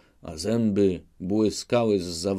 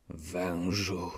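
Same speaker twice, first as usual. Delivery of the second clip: muttered; 8 dB quieter than usual. A narrator's reading. Tuchajbej mutters at the sly Chmielnicki, softer. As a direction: mutter it almost to himself. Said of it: "mruknął".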